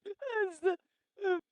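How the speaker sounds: words pitched high and slowed by laughing tears; talking through real laughter; crying with laughter at a friend's stories. Real, not acted. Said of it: "makes me laugh".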